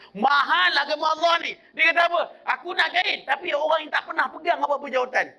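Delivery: high-pitched, driving sermon delivery; fervent preacher pressing the point home; impassioned preaching. Acted.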